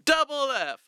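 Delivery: loud. Pretend. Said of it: "I just said double F loud".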